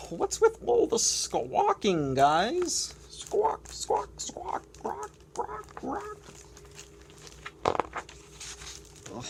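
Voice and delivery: squeaky high grumbling penguin, squawks and all; complaining penguin. Acted. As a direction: whine at the noisy flock. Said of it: "Doing my complaining voice hurts".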